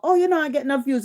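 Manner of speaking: mock-whiny imitation of a rival; mocking her complaint. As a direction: mimic her moaning about views, quickly. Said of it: "She'll come talk about".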